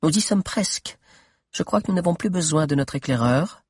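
voix de Mordelia. Acted. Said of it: "fit Mordelia".

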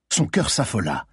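ordinary delivery, nothing marked

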